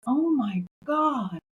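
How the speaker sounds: slow mock exasperation; slow drawn-out oh my God. Boss reacting to her blazer, slow drawn-out dismay. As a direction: stretch it slowly, mock dismay. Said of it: "And he goes".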